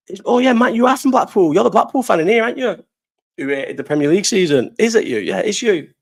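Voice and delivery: high, playful ribbing of a mate; teasing. Real, not acted. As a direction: needle him, grinning. Teasing mate about Blackpool.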